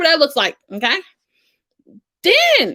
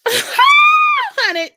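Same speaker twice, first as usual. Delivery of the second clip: high, loud laughing squeal; 5 dB louder than usual. Real, not acted. Coach cracking up over people losing payments.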